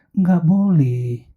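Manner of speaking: drawn-out hesitant refusal; slow refusal. Acted. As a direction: stretch the no, half-willing. Melissa refuses, slowly. (penolakan pelan, setengah hati)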